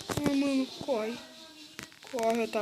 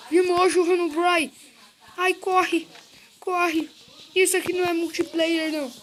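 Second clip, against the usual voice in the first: panicked; 5 semitones higher, 5 dB louder than usual. Real, not acted. Herobrine appears, player flees.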